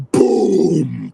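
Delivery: loud, high drawn-out boom; imitated yank. Kid pulls the branch out, loud booming noise. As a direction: punch out a booming sound effect.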